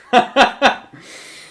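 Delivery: high, loud real laugh; loud burst of laughter. Real, not acted. Big laugh at chat pun. (éclat de rire aigu)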